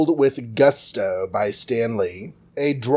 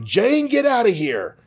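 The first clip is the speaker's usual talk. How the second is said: much higher mock warning cry; urgent warning; 9 semitones higher than usual. Don warns Jane as the Gargoyle breaks in, high urgent call. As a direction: call out to Jane to flee, pitched up. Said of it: "and Don's like".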